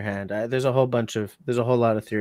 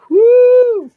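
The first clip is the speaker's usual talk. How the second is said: sudden loud, high whoop of disbelief; excited whoop; 12 dB louder than usual. Real, not acted. Reacting to the four seventy-five pound knife price with a loud, high whoop. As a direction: yelp it out at the price.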